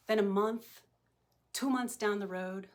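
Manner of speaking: ordinary talk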